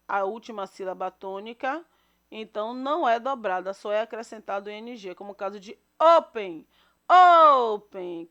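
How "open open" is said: "open" with a long, heavy O at the start; stress on first syllable. Shown as a demonstration. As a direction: hit and stretch the "o" of "open". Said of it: "Qual é a sílaba mais forte?". ("open" com o "o" inicial forte e prolongado)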